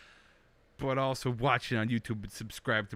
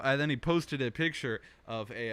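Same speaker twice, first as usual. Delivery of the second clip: ordinary delivery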